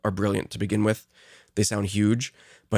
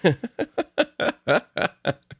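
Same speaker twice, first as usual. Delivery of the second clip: high laugh; 10 semitones higher than usual. Real, not acted. Laughing at the voice crack.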